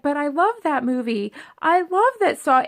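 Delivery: gushing. Retold or imitated. Wife gushing about the movie.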